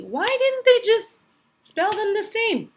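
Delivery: high, mock-exasperated pupil question; playful high-pitched protest; puzzled complaint. Voices a child's puzzled complaint.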